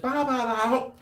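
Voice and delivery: high, far-off mock babble with a stuck mouth; mouth full of milk powder. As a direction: mimic gummed-up mumbling. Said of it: "She'd just".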